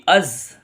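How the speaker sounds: अज़ said with a hard, strong z. Shown as a demonstration. Teacher showing students the hard z. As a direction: press the z in अज़. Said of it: "इसमें सख्ती होगी".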